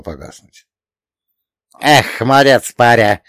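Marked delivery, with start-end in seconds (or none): 1.8-3.2 loud, delighted cheer; joyful yell. A narrator's reading. Old spirit praising the hero's feat, joyful yell. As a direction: cheer the lad on loudly. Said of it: "обрадованно завопил Ломонос".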